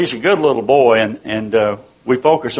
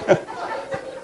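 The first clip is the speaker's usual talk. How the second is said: real laugh; 7 dB quieter than usual. Real, not acted. Laughing at grandson's joke.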